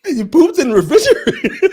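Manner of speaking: high, loud line through laughter; laughing quote. Acted. Host riffing on the diaper story, laughing hard. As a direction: crack up while saying it. Quoting a joke while cracking up.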